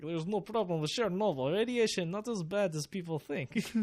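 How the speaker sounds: put-on high, loud hammy Russian accent; thick Russian accent. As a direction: play a cheerful Soviet official, Russian accent. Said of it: "that really thick Russian accent".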